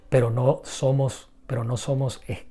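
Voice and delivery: normal talking voice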